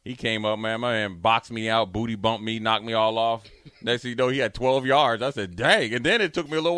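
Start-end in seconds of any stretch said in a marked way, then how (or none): none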